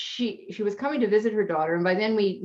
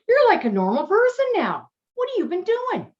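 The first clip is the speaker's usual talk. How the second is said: retold astonishment, pitched much higher; amazed chiropractor; 11 semitones higher, 4 dB louder than usual. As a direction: play the stunned doctor, voice shooting up. Chiropractor amazed at her change.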